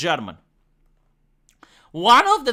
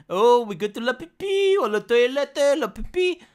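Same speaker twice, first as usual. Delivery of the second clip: mock French; 5 semitones higher than usual. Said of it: "you have a French person that they're saying".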